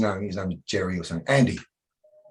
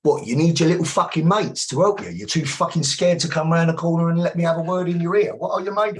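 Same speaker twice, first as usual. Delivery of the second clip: higher and louder, jeering at him in front of his mates; mock aggressive taunt; 8 semitones higher, 4 dB louder than usual. Bouncer taunting a drunk customer, loud jeering challenge. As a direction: goad him hard, raised voice. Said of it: "I went".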